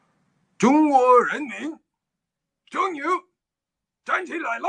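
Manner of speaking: mimicking Mao's proclamation; Chairman Mao impression. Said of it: "it's my Chairman Mao impression".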